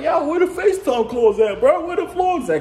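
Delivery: high mock fan voice asking; imitating fans. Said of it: "They be like".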